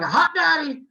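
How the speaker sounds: high, loud imitation of an excited crowd; excited yelling. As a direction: yell it out like the thrilled students. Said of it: "They were all excited, yelling".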